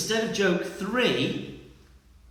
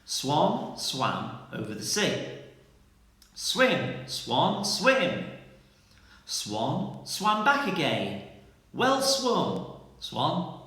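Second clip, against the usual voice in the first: slow, careful tongue twister, word by word; slowly again; much slower than usual. Shown as a demonstration. Teacher repeats the tongue twister slowly for pupils to copy. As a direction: spell it out slowly so they can write it. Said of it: "I'll say it cl-, slowly for you again".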